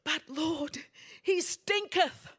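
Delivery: high-pitched, drawn-out protest; protesting sister. Acted. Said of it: "Lazarus's sisters said".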